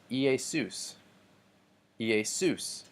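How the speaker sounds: "Iesus" with the stress on the last syllable, ie-SUS; stress on final diphthong. Shown as a demonstration. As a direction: lean on the U sound ending "Iesus". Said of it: "The emphasis goes on the".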